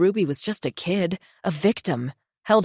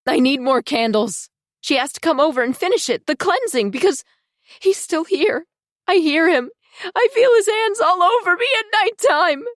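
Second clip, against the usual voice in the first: frantic; 12 semitones higher, 6 dB louder than usual. Acted. Disturbed daughter raving about ghost.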